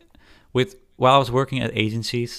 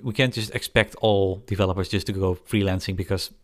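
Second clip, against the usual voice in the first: ordinary talk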